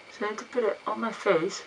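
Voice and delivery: speaking into cloth; muffled through towel. Real, not acted. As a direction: mouth covered by fabric. Seen 0: Dark cloth held over mouth and nose until about 2.2s, then removed.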